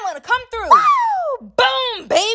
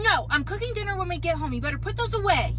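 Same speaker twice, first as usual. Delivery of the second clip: usual speaking voice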